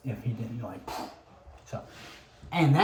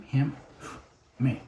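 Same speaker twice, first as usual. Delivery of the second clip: normal talking voice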